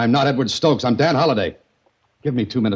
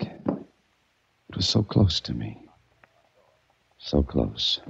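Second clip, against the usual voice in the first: hushed, tempted; 6 semitones lower, 8 dB quieter, much slower than usual. Acted. Gun lies within his reach.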